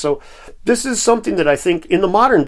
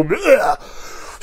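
gagging bleh; 9 semitones higher than usual. Pretend. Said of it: "it just makes you wanna go".

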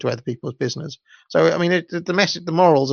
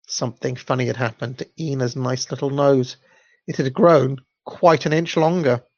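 usual speaking voice

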